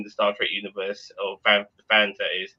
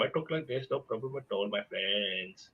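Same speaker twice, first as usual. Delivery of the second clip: softer, put-on Indian accent; parents' Indian accent; 9 dB quieter than usual. Shown as a demonstration. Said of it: "I copy my parents' Indian accent".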